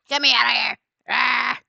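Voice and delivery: ordinary talk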